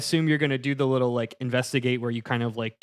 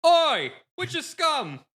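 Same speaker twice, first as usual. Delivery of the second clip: angry guard shouting. Acted. Guard calling out angrily.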